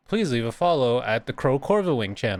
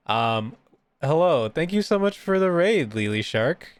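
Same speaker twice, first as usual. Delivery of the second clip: usual speaking voice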